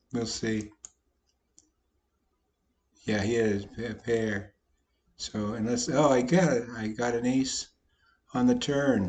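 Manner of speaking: usual speaking voice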